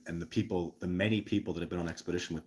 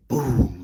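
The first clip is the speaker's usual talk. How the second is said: a sharp, much louder "boom" that punches out; loud boom imitation; 5 semitones higher, 12 dB louder than usual. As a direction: slam the word like a thunderclap. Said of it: "so he went".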